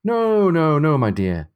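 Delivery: emphatic character's protest; crying out. A narrator's reading. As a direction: cry out the first "no" and ease off. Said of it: "cried Stephen".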